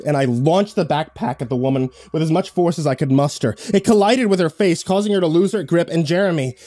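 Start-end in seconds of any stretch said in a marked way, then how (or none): none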